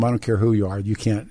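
ordinary delivery

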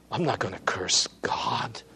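soft whispered vow; hushed whisper of Job's resolve. Acted.

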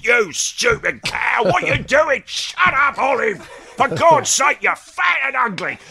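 high, hammed-up shouting at Olive; a mock tirade at his wife, hammed-up and high; mock ranting husband. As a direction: yell it like a bullying sitcom husband. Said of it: "all he's pretty much says is".

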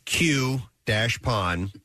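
slow, separated "Q dash pon"; spelled-out poll option. Shown as a demonstration. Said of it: "Coupon or coupon".

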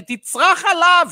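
imitating a viewer urging him to scream; viewer demanding a yell. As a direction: bellow it like an angry viewer. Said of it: "ואומר לי".

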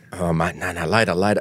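low, hushed mock brush-off; secretive. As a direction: imitate someone furtively putting off a mate. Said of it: "so secretive".

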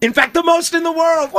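high, loud shouting like a crowd; mock fan shout. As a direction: yell it like a football hooligan.

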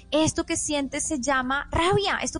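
lento y agudo, como a un niño pequeño; enseña al niño a nombrar su emoción; acompañamiento empático. Shown as a demonstration. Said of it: "Acompaña la emoción". (explicación tierna y pausada)

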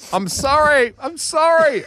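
high, panicked comic scream; acted scream of sorry; screamed apology. A narrator's reading. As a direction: cry out the apology at a high pitch. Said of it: "I screamed".

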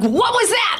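startled, high question. Retold or imitated. Wife hearing the freezer, startled and high-pitched. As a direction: jump at the sudden noise. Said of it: "And I'm like".

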